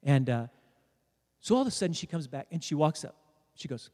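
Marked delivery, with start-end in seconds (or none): none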